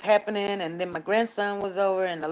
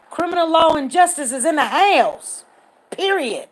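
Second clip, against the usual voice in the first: hyped; 7 semitones higher, 8 dB louder than usual. Real, not acted. Hyped livestream opening.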